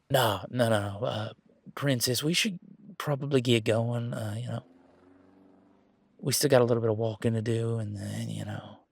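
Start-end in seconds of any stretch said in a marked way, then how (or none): none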